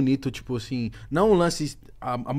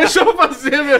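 mock pregnant protest; 11 semitones higher, 6 dB louder than usual.

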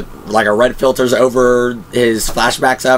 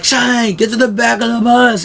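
badass Rick; 11 semitones higher than usual. Rick rescuing Shane on the bus, imitated in a much higher heroic call. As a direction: call to Shane like the hero arriving. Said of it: "comes back through all badass".

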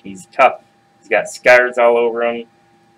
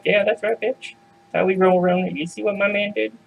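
higher, softer cocky gloating; gloating goats; 7 semitones higher, 3 dB quieter than usual. Acted. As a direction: strut over the bridge bragging.

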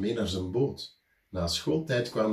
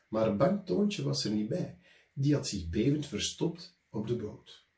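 usual speaking voice

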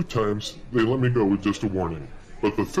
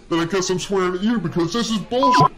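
louder, higher mock-defiant blurt at the cop; retold outburst; 8 semitones higher, 6 dB louder than usual. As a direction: throw the swear word right back at him, loud. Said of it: "And I said".

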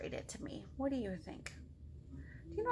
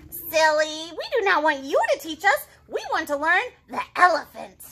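chirpy squirrel; 4 semitones higher, 8 dB louder than usual. Acted. Squirrels correct Piggy.

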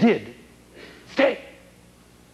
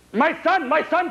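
a much higher, fond cry repeated; cried out; 11 semitones higher than usual. Acted. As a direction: cry out to the son you thought lost. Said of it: "cried John".

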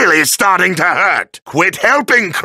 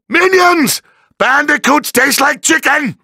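calling minions out; 7 semitones higher than usual. Acted. Villain coaxes minions to attack.

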